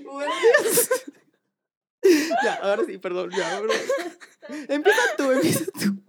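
high giggly apology; apologizing through laughter; stifled giggles. Real, not acted.

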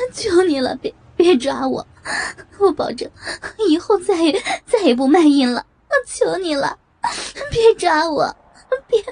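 带哭腔高声哀求; 跪着哭求警察别抓她; 哭着求饶. Acted. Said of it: "张凯丽哭着". (哭着苦苦哀求)

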